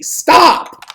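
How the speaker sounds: high, loud shout; exasperated yell. Real, not acted. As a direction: shout it, fed up. Yelling at chat.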